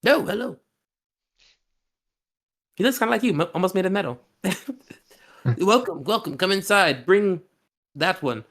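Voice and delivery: high, delighted greeting voice; welcoming host. Acted. Armored host greeting the party, high delighted voice. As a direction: beam and welcome them in.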